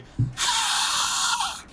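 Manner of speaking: airy squeak that won't voice; failed high note. Shown as a demonstration. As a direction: try a high pitch, only air comes out. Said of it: "if I wanna go".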